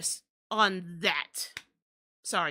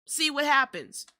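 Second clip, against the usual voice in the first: mock caller; 4 semitones higher, 4 dB louder than usual. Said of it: "being like".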